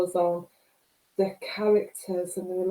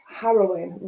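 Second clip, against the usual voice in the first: ordinary talk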